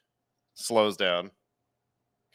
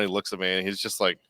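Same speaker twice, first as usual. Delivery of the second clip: ordinary talk, nothing marked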